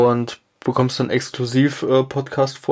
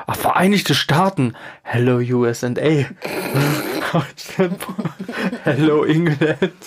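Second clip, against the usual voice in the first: giggling; 2 semitones higher than usual. Real, not acted. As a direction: laugh through the hello. Giggly greeting to the US.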